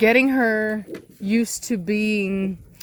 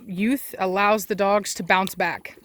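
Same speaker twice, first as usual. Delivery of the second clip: usual speaking voice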